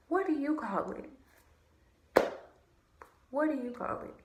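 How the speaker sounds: indignant question. Real, not acted. Indignant rhetorical question to him.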